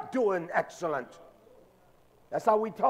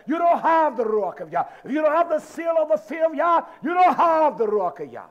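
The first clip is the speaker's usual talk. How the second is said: loud, higher, rhythmic preaching refrain; full-force preaching; 5 semitones higher, 4 dB louder than usual. Real, not acted. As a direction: hammer each line to the congregation.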